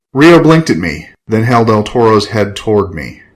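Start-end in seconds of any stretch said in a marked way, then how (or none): none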